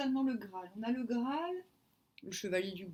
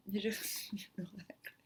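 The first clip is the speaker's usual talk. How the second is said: whispering; 2 semitones lower, 7 dB quieter than usual. Real, not acted. Confiding a funny reveal.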